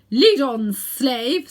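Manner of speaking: loud imperious order; commanding. A narrator's reading. Witch ordering Uncle Andrew out. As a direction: command him ahead.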